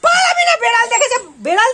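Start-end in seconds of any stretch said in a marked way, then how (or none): 0.0-0.5 high laughing shout after the runaway pet; real laughing yell, much higher; excited calling out. Real, not acted. Chasing the pet after a cat.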